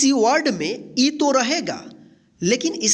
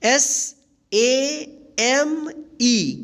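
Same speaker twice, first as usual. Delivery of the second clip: each letter held long; spelling out; 3 semitones higher, much slower than usual. Shown as a demonstration. Teacher spells the next example, slow stretched letters.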